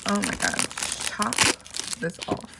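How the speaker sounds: ordinary talk, nothing marked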